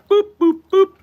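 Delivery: very high, loud spoken beeps; short high boops imitating keypad tones; phone dial beeps. Barista mimicking dialling the cops, high beeping sounds.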